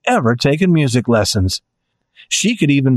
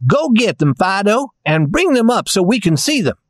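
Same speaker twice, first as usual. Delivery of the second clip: tin soldier voice; 6 semitones higher than usual. A narrator's reading.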